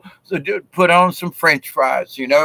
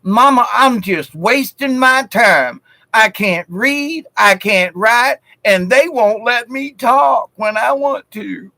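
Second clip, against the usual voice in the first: put-on whiny little girl; 5 semitones higher than usual. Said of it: "the little girl looks up and she goes".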